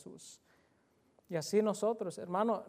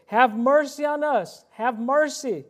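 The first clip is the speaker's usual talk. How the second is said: higher, louder pleading shout, slow on mercy; desperate cry; 6 semitones higher, 10 dB louder, slower than usual. Acted. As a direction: cry out for mercy as if about to die. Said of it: "They're shouting to us". (grito desesperado, más alto y fuerte, pidiendo misericordia)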